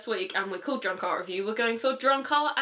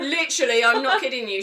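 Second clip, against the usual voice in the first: usual speaking voice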